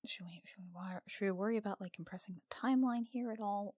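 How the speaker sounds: normal talking voice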